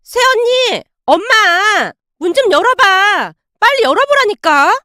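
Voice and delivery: high shouts through the door; yelling at the door. Acted. Sister-in-law yelling from outside the door, high shouting. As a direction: yell for them to open up. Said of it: "소리를 질러대고 있었어요".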